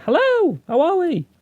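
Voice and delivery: very high, singsong hello to a crowd; mock stage greeting. Comic opening his set to a drunk crowd, high-pitched greeting. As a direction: greet the room in a silly high voice.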